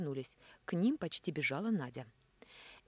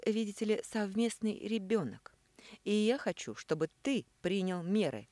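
cruel; 5 semitones higher, 5 dB louder than usual. A narrator's reading. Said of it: "жестоко заявила она".

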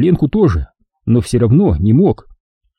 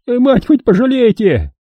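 desperate plea; 12 semitones higher than usual. A narrator's reading. Doomed man begs.

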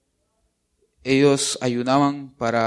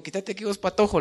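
put-on higher sharp call, shooing a boy away; shooing the kid; 7 semitones higher, faster than usual. Pastor retelling how he yelled at the boy, sharp higher call. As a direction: tell the naughty kid to scram. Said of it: "le digo".

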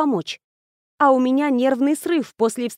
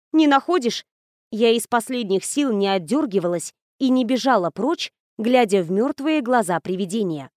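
ordinary delivery

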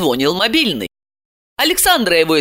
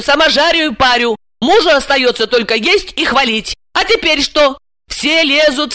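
high-pitched, heated rant; exasperated tirade; 8 semitones higher, 4 dB louder than usual. A narrator's reading. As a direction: pile up grievances, fed up. Ася срывается в гневную тираду. (возмущённая тирада)

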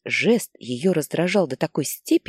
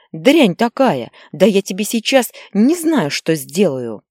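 much louder, higher furious mother; enraged scream; 4 semitones higher, 7 dB louder than usual. Acted. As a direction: lose it completely, slowly at first. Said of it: "заорала она". (мать в ярости орёт на сына)

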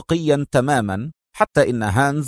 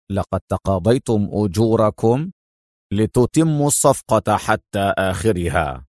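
low-pitched stern general; 4 semitones lower than usual. A narrator's reading. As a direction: remind them sternly, in a lowered voice. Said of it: "قال الجنرال في صرامة". (بصرامة وبصوت منخفض)

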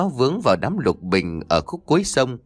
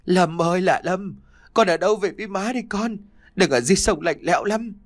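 high, fast, keening lament; wailing mother; 6 semitones higher than usual. A narrator's reading. Mother crying out to her drowned son, wailing. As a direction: beat her chest and wail for her son. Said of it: "đấm tay thùm thụp vào ngực mà khóc".

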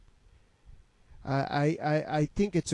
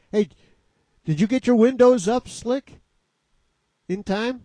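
calling out teasingly; 6 semitones higher, 6 dB louder than usual. Real, not acted.

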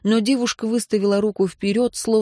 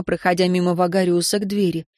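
plain reading voice

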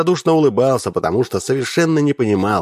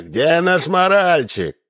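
drawn-out mock shout, a bit higher; 8 semitones higher, slower than usual. Akhmed shouting for his missing item, a mild mock shout. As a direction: call out slowly for the lost item. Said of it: "кричал Ахмед".

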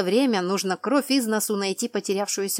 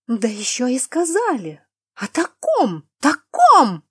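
high, loud scandalized outburst; mounting outrage, voice climbing; choking with indignation; 5 semitones higher, 9 dB louder, slower than usual. A narrator's reading. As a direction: splutter it, building to a cry. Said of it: "задыхалась от возмущения".

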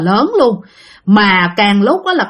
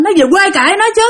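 higher-pitched mock hollering, little extra volume; irritated scolding, pitched up; retold yelling outburst; 6 semitones higher, faster than usual. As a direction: imitate someone kicking up a noisy fuss. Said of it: "nó mới la làng lên".

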